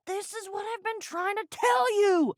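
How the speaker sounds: high, exasperated outburst. Acted. Frustrated at a pirate who will not listen, exasperated.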